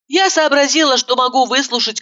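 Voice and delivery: plain reading voice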